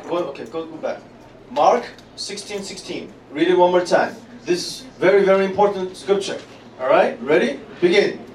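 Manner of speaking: ordinary talk, nothing marked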